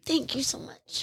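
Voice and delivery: soft, slow and tearful; barely voiced through crying; tearful thanks. Real, not acted. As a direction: thank them through real tears. Guest crying, tissues fetched.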